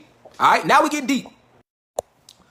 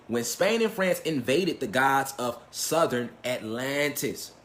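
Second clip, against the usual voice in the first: slow, quiet emphasis; 7 semitones lower, 9 dB quieter, much slower than usual. Shown as a demonstration. Line repeated slowly and quietly.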